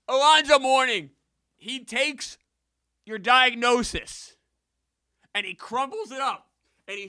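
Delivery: very high, loud hollering; over-excited rant. Real, not acted. Streamer hyping Alonzo Mourning playing hurt, wildly excited. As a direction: build to a screaming, triumphant outburst. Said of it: "I got very excited".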